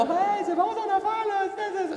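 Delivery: high, slow, drawn-out mock praise; mock enthusiastic listener. Audience member he pretends would praise him, mock gushing. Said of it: "on fait pas".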